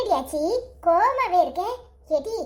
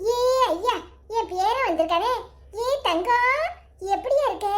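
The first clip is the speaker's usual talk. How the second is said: ordinary talk, nothing marked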